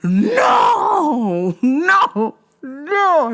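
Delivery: high wailing no no no; hammed-up despairing wail, pitched high; mock wail. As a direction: whine it like you're about to cry. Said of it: "I was like".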